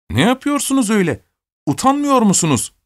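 high-pitched scolding cry; indignant shout. A narrator's reading. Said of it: "diye bağırdı Alyosha".

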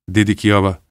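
plain reading voice

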